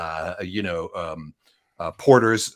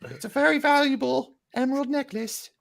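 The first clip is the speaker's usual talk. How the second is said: much higher falsetto lady voice, still half laughing; hammed-up man playing a woman; Shrek stepsister voice. GM repeats the NPC's line in the comic woman voice. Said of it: "you kinda sound like the, stepsisters in Shrek".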